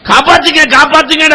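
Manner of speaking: high pleading cry; child's cry for help. Retold or imitated. Drowning girl begs to be saved, mildly voiced cry. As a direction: scream for someone to save her. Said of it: "அலறிய அலறல்".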